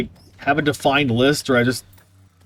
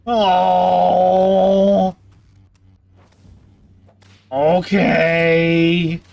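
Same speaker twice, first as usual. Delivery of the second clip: long drawn-out, raised character voice; Torback; 5 semitones higher, 3 dB louder, much slower than usual. Acted. Streamer agreeing to a viewer's request, drawn-out character voice. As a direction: stretch each word out in character. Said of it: "Liz wants me to talk like Torback".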